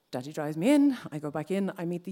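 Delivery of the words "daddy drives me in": ordinary delivery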